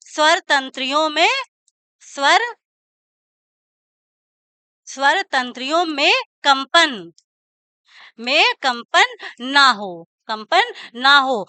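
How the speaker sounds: drawn-out words, long pauses; slow dictation. Shown as a demonstration. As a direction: repeat each piece slowly for note-takers. Teacher continues dictating definition. (धीरे-धीरे दोहराकर लिखवाते हुए)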